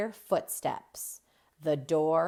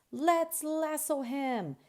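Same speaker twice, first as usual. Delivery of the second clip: high, slow, excited call; rally the class to the rescue; eager shout; 9 semitones higher than usual. Acted. Noah rallying classmates to free Maddie, high eager call. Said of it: "Noah shouts".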